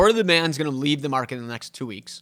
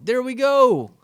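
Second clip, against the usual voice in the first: triumphant; 11 semitones higher, 8 dB louder, slower than usual. Real, not acted. Triumphant after punchline.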